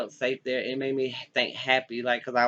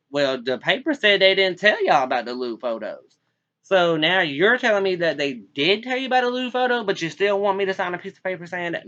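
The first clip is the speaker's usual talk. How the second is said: loud, higher, indignant challenge; retold heated argument; 6 semitones higher, 7 dB louder than usual. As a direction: press him hard, voice raised.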